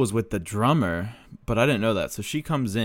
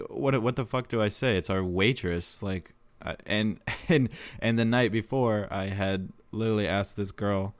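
ordinary talk

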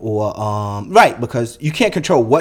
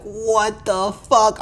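drawn-out, very slow, higher amazed; stunned disbelief; 9 semitones higher, much slower than usual. Retold or imitated. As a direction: stretch it out in awe at the room. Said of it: "I was like".

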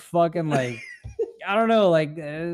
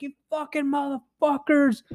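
angry cyclist; 11 semitones higher, 3 dB quieter than usual. Retold or imitated. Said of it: "He's like".

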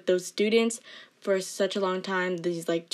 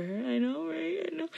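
high mock voice; 8 semitones higher, 6 dB quieter than usual. High self-mocking voice.

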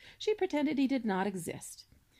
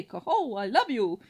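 hammed-up swan call; 7 dB louder than usual. Cob swan quoting the love call to his wife, grandly. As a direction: ring out the coho grandly.

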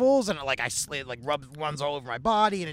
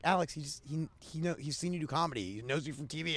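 quiet explanation; 9 dB quieter than usual. Retold or imitated. Friend calmly explaining to him.

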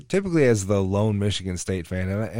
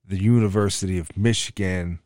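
ordinary delivery

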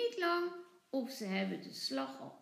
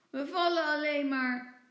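louder, mildly grumpy complaint; grumbling; 4 semitones higher, 8 dB louder than usual. A narrator's reading. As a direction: grouse a bit like a sulky girl. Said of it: "moppert Mieke". (licht mopperend)

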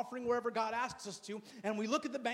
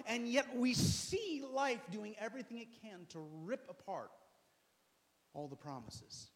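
normal talking voice

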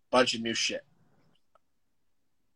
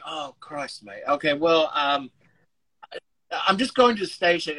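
put-on British mate-talk; retold British accent, playing the hapless visitor; 3 dB louder than usual. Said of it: "I started speaking in a British accent".

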